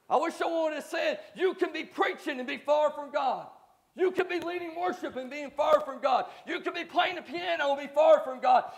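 loud, high-pitched preaching shout; full-force preaching. Acted. Pastor calling out the church, shouting. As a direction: preach it hard to the pews, rising to a shout.

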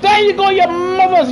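high, loud taunting cheer; gloating yell. Real, not acted. Gamer taunting the cars he passed, gloating yell. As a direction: rub it in their faces.

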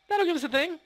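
playful high-pitched repeat; squeaky high voice. Shown as a demonstration. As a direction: squeak it out like a cartoon. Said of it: "repeats line in new voice".

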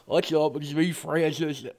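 low, soft and held back, barely keeping it together; quietly holding back the rage; forced calm. Acted. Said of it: "I try to calm down before I make this video".

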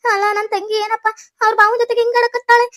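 high-pitched, drawn-out lament; distressed wailing. Acted. Wife outside hears husband with her sister, wailing. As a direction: wail and plead at the shut door. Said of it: "ಹೆಂಗ್ ಕಿರ್ಚಾಡ್ತಾಳೆ".

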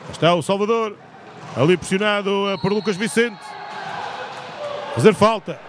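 live sports commentator calling action; play-by-play commentary. Real, not acted. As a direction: call the play as it happens.

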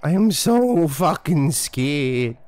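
sarcastic wail with long held vowels; hammed-up, unconvincing dread; mock fear. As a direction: fake being terrified, dragging the words out. Mocking the horror game's scares.

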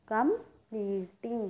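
completing very slowly in three parts, com, ple, ting; syllable by syllable. Shown as a demonstration. Teacher shows three sounds, completing split syllable by syllable. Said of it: "three sounds create हुई हैं".

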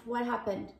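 ordinary delivery

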